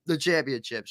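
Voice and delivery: words breaking up as the voice drops out; voice cracking. Real, not acted. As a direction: voice gives out for a moment. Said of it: "Sorry, I lost my voice for a second".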